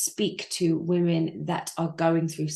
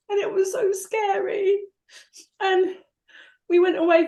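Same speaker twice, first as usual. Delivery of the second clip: fighting back sobs as she speaks; crying; 12 semitones higher than usual. Real, not acted. Grieving mother breaks down telling the interviewer, crying. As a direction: voice breaking high with tears. Mother tearfully recalls failing daughter.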